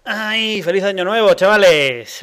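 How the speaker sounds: loud, high, cheerful new year shout; excited greeting. Real, not acted. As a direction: greet the listeners with a big burst of joy. Opening the show, greeting listeners.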